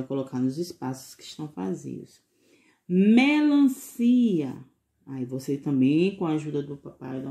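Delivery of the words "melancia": Melancia drawn out very slowly and louder; slow syllables. Shown as a demonstration. Teacher to pupils, sounding out the fruit very slowly. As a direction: stretch each syllable of Melancia. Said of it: "Bem devagarzinho pra saber qual é a vogal".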